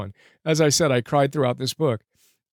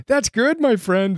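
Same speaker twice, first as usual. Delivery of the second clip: high-pitched, welling up as you read; emotional; 8 semitones higher than usual. Real, not acted. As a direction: overcome by the story. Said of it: "I get emotional reading this".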